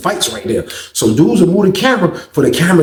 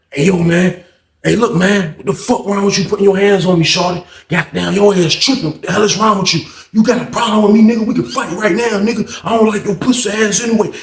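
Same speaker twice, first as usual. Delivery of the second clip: high, heated street challenge; angry confrontation; 6 semitones higher than usual. Retold or imitated. Inmate squaring up to Randall on the stairs, heated challenge.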